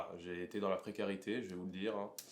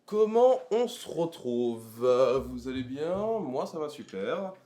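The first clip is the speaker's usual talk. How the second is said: pompous candidate; 5 semitones higher, 9 dB louder, slower than usual. Acted. Opens his candidate video.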